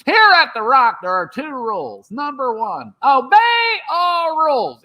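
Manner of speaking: high, emphatic deputy laying down rules; Barney Fife. Acted. Comic doing deputy for the press, high officious impression. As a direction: punch high on key words, drop between. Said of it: "Do Barney, both of you".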